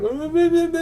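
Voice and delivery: high whiny blah blah, hammed-up; mock whining. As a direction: imitate a nagging woman's whine. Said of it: "bitching and whining and complaining".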